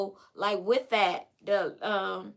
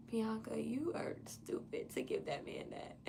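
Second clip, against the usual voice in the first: hushed self-reproach; soft whispered scolding of herself; 13 dB quieter than usual. Retold or imitated. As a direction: whisper it at yourself, disgusted.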